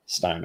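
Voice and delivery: Stein said with a long I, like stine; Stein, the long I version. Shown as a demonstration. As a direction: settle on Stein, rhyming with wine. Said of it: "I'm gonna say Stein".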